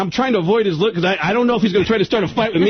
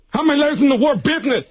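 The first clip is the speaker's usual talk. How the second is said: high, exasperated quizzing in a mimicked voice; pissed-off Tracy Morgan; 8 semitones higher than usual. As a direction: quiz him like he's an idiot, pitched high. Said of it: "Tracy got pissed and pulled the car over".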